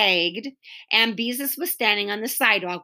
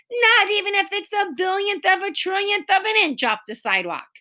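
put-on teasing boy; 9 semitones higher than usual. Robert teasing, higher put-on voice.

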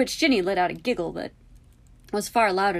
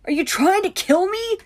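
loud, very high squeak; 13 semitones higher, 9 dB louder than usual. A narrator's reading. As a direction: squeak it out in horrified protest. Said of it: "Harry squeaked".

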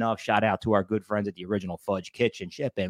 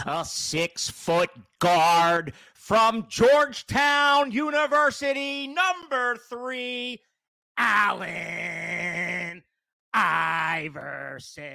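drawn-out, high, booming player introduction; sports announcer intro; 8 semitones higher, much slower than usual. Pretend. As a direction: call him out like an arena announcer.

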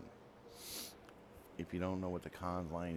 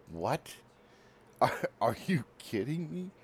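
laughing disbelief; 8 semitones higher, 5 dB louder than usual. Retold or imitated. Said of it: "Lady busted gut laughing".

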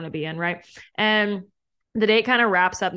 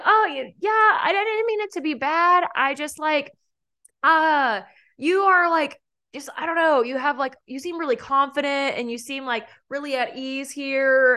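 flustered guy; 6 semitones higher than usual. Retold or imitated. Imitating flustered date.

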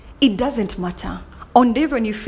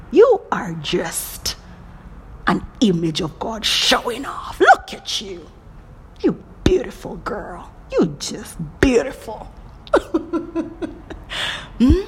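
normal talking voice

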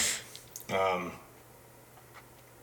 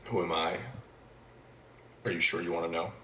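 Don Draper impression; 6 semitones lower, 5 dB quieter, faster than usual. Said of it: "do it as Don Draper".

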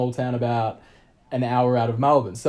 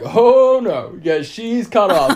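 loud high "Oh, no" from the bartender; mock bartender cutting her off; bartender refusing; 7 semitones higher, 6 dB louder, much slower than usual. Bartender telling him she's cut off, loud mock exclaim.

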